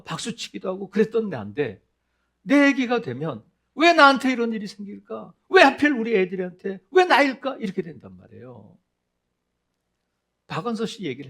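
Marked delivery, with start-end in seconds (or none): 3.8-7.6 mock "why me" protest, pitched up; protesting lament. Person struck by misfortune asking why, aggrieved protest. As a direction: protest the unfairness, louder on each question.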